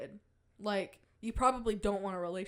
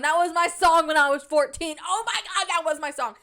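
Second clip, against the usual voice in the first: excited gushing; 6 semitones higher, 13 dB louder than usual. Real, not acted. Gushing about teenage favourite song.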